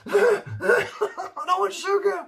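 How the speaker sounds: very high, loud, drawn-out mock wail; imitated freak-out. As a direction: squeal in comic panic, laughing through it. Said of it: "he's just freaking out".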